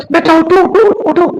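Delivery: a mother shaking her sleepy son awake; mother waking son. Acted.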